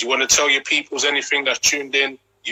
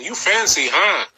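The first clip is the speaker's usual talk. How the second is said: quoting the song; 8 semitones higher, slower than usual. Retold or imitated.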